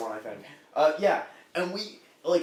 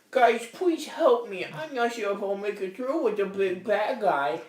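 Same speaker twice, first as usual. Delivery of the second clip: cutesy scared character; 3 semitones higher than usual. Retold or imitated. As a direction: plead in exaggerated baby talk. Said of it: "he was being like".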